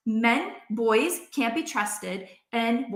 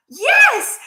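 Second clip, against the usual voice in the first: much higher, loud squealed yes; emphatic yes; 13 semitones higher, 11 dB louder than usual. Retold or imitated. Answering old crush about being dumped, squealed yes. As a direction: fire back an eager yes. Asked if she remembers dumping.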